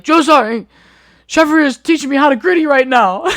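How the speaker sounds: high, loud panicked pleading; panicked recruit. Retold or imitated. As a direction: blurt it out in a panic. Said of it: "And I was like".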